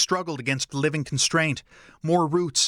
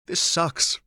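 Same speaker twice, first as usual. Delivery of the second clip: words forced past a tight throat; choked up; 3 semitones higher than usual. A narrator's reading. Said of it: "he choked out".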